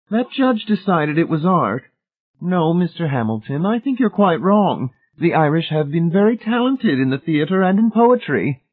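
high-pitched, decided lady's voice; Missus Pritchett's firm verdict. A narrator's reading. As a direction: pronounce judgement in a high lady's voice.

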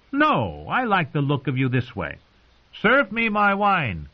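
put-on high, taunting young king voice; a spoilt boy king needling his uncle; cruel boy king. Joffrey taunts his uncle, high and mocking.

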